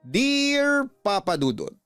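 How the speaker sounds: ordinary talk, nothing marked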